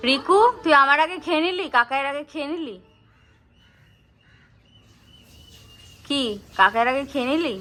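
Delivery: playful scolding. Real, not acted. Teasing the kid for eating first.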